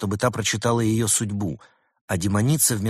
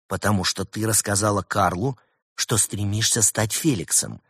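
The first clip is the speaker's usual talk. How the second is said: usual speaking voice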